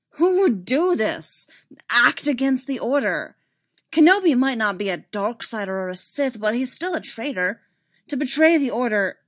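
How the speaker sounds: heated, frustrated outburst. A narrator's reading. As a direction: rail against the betrayal in frustration.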